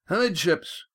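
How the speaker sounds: high-pitched helm command; high carrying call; yelled order. A narrator's reading. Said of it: "he yelled".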